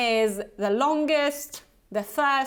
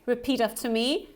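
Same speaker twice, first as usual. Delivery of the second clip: ordinary talk, nothing marked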